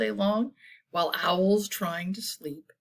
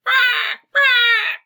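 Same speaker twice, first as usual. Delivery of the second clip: high, loud jay screech; screaming jay; 11 dB louder than usual. A narrator's reading. Reader voicing jays that keep owl awake, screaming. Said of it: "The jays screamed".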